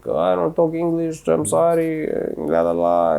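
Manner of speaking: high, light fake no-English voice; fake confused foreigner. To the store staff, feigning he speaks no English. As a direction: play dumb pretending no English. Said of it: "there was a Spanish dude".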